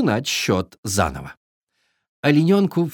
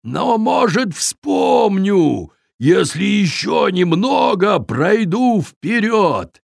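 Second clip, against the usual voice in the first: cheering up; 6 semitones higher, slower than usual. A narrator's reading. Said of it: "широко заулыбался".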